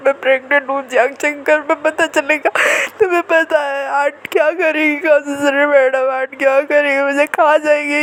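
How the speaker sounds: on the verge of tears, lightly acted; tearful panic. Pregnant girlfriend fretting to boyfriend, a touch tearful. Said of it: "ये कह के वेंकिंग रोने लगी".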